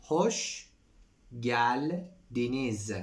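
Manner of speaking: Hoş geldiniz drawn out very slowly, each syllable clear; slow teacher model of Hoş geldiniz. Shown as a demonstration. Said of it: "Hoş geldiniz (repeated again)".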